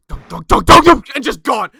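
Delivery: loud thudding dunks, rising; loud clomping noise. Retold or imitated. Game master making monster footsteps, loud clomping. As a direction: stomp out heavy footfalls with the voice. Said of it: "like, loud, like, clomping noise".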